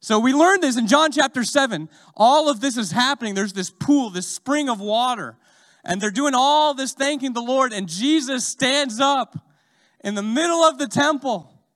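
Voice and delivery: loud, high preaching voice; full-force preaching. Real, not acted. Preacher building to Jesus crying out at the feast. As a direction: drive it home to the room.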